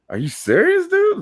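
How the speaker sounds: high-pitched retold disbelief; incredulous. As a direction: ask it in mock disbelief, voice shooting up. Retelling incredulous retort to driver.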